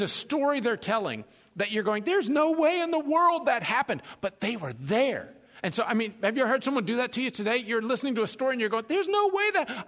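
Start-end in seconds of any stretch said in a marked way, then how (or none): 2.0-4.0 high, louder disbelief; pitched-up skeptical reaction; incredulous. Acted. Incredulous listener reacting to a story. 8.9-9.8 high-pitched disbelief; high skeptical protest; incredulous. Acted. Listener cut off mid-disbelief.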